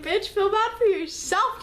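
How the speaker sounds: high, slow, loud scornful snap; scornful retort. Retold or imitated. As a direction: fling it back at him in disgust. Quoting her angry retort.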